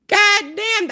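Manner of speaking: high, stunned outburst; exclaiming in shock at the story; shocked exclamation. Real, not acted. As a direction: blurt it out in disbelief.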